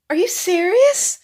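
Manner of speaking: laughing at her own shock; stunned disbelief. Retold or imitated. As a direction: throw it up high in shock. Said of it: "I'm like".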